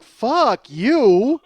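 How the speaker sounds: high, loud drawn-out outburst; indignant. Acted. Comic's inner answer to the stranger, indignant. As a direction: belt it out in outrage. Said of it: "I'm indignant".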